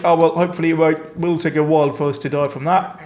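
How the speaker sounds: ordinary talk, nothing marked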